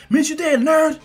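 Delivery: high, loud taunt on the run; acted cocky call-out. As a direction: shout back at the friend you're leaving behind. Rival runs off taunting.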